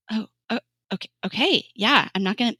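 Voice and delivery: mildly taken aback, stammering; kinda shocked. Retold or imitated. Laboring mother answering her midwife, mildly shocked. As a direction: act out a small stunned stammer of agreement. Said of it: "being kinda, like, shocked".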